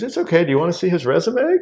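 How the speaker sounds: high, loud imitation of veiled annoyance; mock-polite, implying an unwelcome interruption; pointed politeness. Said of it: "Why did you interrupt?".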